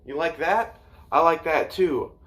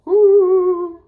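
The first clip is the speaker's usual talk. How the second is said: excited whoop; 8 dB louder than usual. Real, not acted. Cheering on camera in costume.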